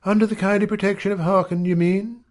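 high, quick sarcastic woman; high-pitched, quick sarcastic query; quiet sarcasm. A narrator's reading. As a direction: needle him sarcastically in a high voice. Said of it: "she said with quiet sarcasm".

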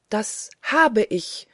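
slow, emphatic exultation; inward rejoicing. A narrator's reading. Narrator rejoices inwardly that he has learned prayer, jubilant. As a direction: stretch the words with triumph. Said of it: "jubelte es in mir".

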